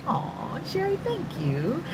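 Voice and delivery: touched thanks. Real, not acted. Host thanking a viewer for a loving comment, touched and tender. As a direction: melt a little as you thank them softly.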